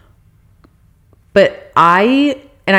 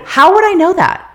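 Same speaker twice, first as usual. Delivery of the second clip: indignant, higher; 11 semitones higher than usual. Real, not acted. Ranting about the yelling valet.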